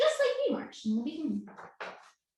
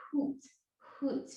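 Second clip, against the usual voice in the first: soft hoot demo; 7 dB quieter than usual. Shown as a demonstration. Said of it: "Can you make that noise".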